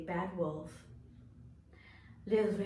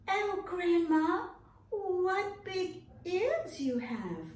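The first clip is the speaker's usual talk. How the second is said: put-on high, slow wondering child; amazed little girl asking slowly; 11 semitones higher, 3 dB louder, slower than usual. Girl questions grandma.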